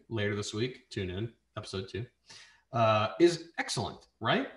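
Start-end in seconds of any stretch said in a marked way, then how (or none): none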